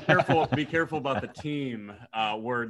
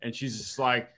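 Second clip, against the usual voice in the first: usual speaking voice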